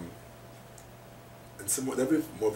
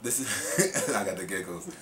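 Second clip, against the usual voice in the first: ordinary talk, nothing marked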